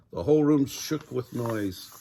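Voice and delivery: ordinary delivery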